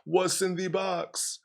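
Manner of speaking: high, restrained quoted cry; small, drawn-out mock yell; mock scream. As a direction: quote a scream without the volume, slow and high. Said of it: "until they scream".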